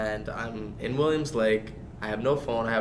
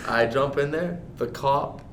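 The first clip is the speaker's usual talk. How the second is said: ordinary delivery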